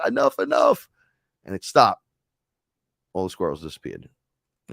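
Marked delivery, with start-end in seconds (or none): 0.1-0.9 high, loud mock outburst; exasperated command. Robed being halting the runaway squirrels, exasperated high shout. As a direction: cut it off in a sudden high-pitched cry.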